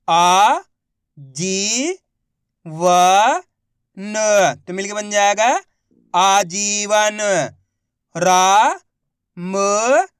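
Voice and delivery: slow, loud, one syllable at a time, then the whole word drawn out; patient teacher spelling aloud; syllable-by-syllable reading drill. Shown as a demonstration. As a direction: sound out each syllable clearly for a child, then blend it slowly.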